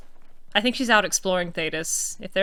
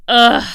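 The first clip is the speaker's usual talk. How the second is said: loud exasperated ugh; 11 dB louder than usual. Real, not acted. Suffering over the painting.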